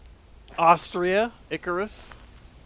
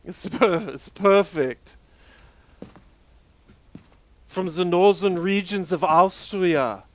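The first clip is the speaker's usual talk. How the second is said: hammed-up Austrian accent drifting toward French, louder and higher; slipping Austrian accent; 4 semitones higher, 8 dB louder than usual. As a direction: insist it's northern Austrian in a loud mock accent. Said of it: "Now it's, like, French or something?".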